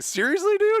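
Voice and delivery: squeaky high disbelief; incredulous. Retold or imitated. Band reacting to the drummer's news with disbelief. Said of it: "and they're like".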